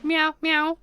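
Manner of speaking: high playful meowing; nervous cat voice. Pretend. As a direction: meow like a needy kitten. Meowing back and forth.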